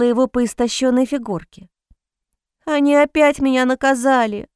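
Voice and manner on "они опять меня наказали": put-on crying child. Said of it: "плакал он".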